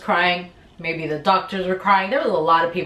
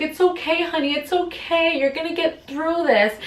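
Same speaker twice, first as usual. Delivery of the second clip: imitating mom soothing; 9 semitones higher than usual. Mom comforting her in labor.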